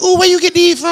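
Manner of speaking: high, squealing delight over the gift; excited girlfriend. Retold or imitated. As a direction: gush like she just opened the perfect present.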